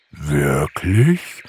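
slow bear voice, rising at the end; sleepy bear question. A narrator's reading. Bear asks Pixie whether it's true, sleepily wondering. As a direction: ask as a drowsy bear. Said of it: "fragte Umberto".

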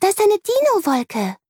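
put-on high, delighted child's voice; beaming child. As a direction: share the discovery with glee. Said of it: "ruft Amelie und strahlt". (hoch, begeistert, strahlend)

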